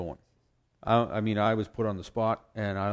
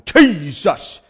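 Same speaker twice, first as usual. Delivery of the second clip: loud "Jesus", stretched out; mock prayer shout; 7 semitones higher, 13 dB louder than usual. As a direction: shout the name like a revival preacher. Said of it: "You don't have to go".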